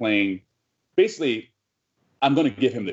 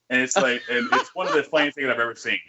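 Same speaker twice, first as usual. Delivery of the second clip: ordinary talk, nothing marked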